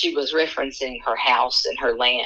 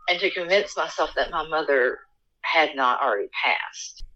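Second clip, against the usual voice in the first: usual speaking voice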